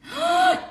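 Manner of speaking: sharp high gasp; shocked gasp. Real, not acted. Streamer finds the moon placement confirmed, shocked high gasp. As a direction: gasp in sudden astonishment. Astonished at checking the chart.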